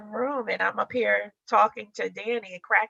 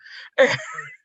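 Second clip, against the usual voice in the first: high breathless laugh; 4 dB louder than usual. Real, not acted. Laughing at husband's remark.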